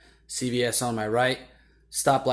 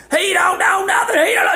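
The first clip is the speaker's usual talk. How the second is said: very high, loud shouting over someone; full-volume, high mock hollering; yelling; 9 dB louder than usual. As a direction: imitate a whole crowd yelling at once. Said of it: "They all start yelling".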